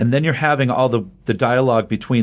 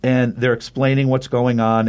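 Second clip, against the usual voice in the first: normal talking voice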